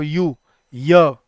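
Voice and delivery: slow "यू, य", stretching the y glide; drawn-out y sound. Shown as a demonstration. Teacher stretching "य" for students. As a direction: say "य" slowly to show the y sound. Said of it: "बोल के देखो".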